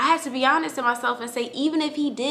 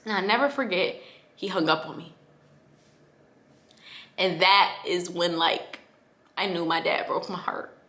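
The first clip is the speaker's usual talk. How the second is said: ordinary talk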